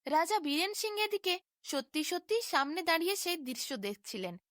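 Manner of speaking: usual speaking voice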